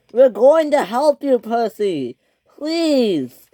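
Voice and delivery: high, loud plea; pleading. A narrator's reading. Engines to Percy who is hitting them, pleading. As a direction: beg him to stop.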